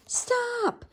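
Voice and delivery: ordinary delivery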